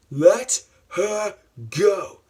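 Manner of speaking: slow, loud, high demand; theatrical forceful shout; acted stern command. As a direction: order it one drawn-out word at a time.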